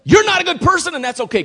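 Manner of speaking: high, loud preacher's declaration; belted-out good news; loud proclamation. Acted. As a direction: proclaim it emphatically, pitched right up. Acted proclamation of the gospel message.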